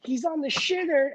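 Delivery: ordinary delivery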